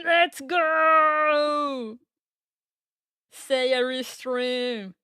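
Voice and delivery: rally the chat, loud and high; hype cheer. Real, not acted. Hyping up the restream.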